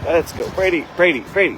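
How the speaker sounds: loud high call to the dog; calling the dog loudly. Real, not acted. Shouting at stubborn dog.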